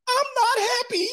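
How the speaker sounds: tiny high squeak breaking into laughter; laughing falsetto squeak. Retold or imitated. Wife whining to husband, squeaky falsetto through laughter. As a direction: squeal it while cracking up.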